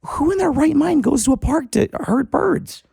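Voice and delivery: high-pitched imitation of the indignant woman; high mock outraged woman. Fisherman mimicking the bird lady's outrage, high and mocking. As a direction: squeak it out like a scandalized parkgoer.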